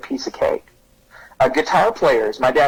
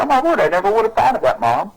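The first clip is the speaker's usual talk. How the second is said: fast, high mock kid voice, amazed; 8 semitones higher than usual. Kid thanking mom, lightly mock amazed. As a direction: play the kid who just got it. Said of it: "They say".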